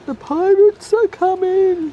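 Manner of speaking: high, loud and slow, drawn out like a town crier; dramatic pirate warning. Pretend. Repeating the pirate warning to husband, loud hammed-up alarm. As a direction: ham it up as a mock alarm.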